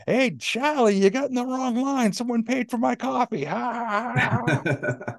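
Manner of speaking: high, hollering regular razzing his buddies, ending in a drawn-out laugh; fire plug of a guy. Retold or imitated. Little guy crows to his friends about free coffee, high mocking holler. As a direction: imitate a cocky little guy crowing, high-pitched. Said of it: "this little fire plug of a guy turns around".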